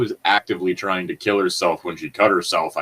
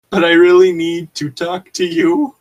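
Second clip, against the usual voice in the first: higher, louder mock begging; 7 semitones higher, 6 dB louder than usual. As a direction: plead hard, hammed up. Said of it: "I begged pleasingly".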